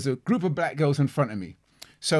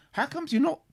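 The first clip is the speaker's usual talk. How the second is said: baffled disbelief; 11 semitones higher, faster than usual. Retold or imitated. His shocked thoughts at the club.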